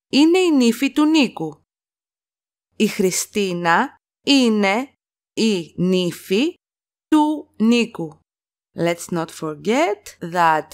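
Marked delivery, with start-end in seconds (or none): 2.7-8.2 slow chunked repeat. Shown as a demonstration. Teacher to students, slow phrase-by-phrase model. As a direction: split into chunks with pauses between. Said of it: "teacher repeats sentence slowly in chunks".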